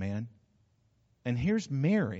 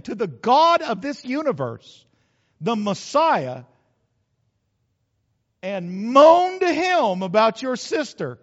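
louder and higher, slow, driving the point home; preaching at full force; 8 semitones higher, 8 dB louder than usual. Acted. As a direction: build to a fiery preacher's peak. Preacher builds to fervor.

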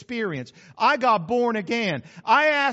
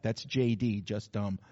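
soft, lower, throwaway punchline; quiet punchline aside; 12 semitones lower, 7 dB quieter, slower than usual. Real, not acted. Preacher murmuring his old nickname joke quietly. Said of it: "Some of you will get that".

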